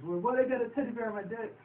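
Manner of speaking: high-pitched imitation of a baffled complaint; mock bewilderment. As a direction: act out a startled question. Voicing the character's bewilderment.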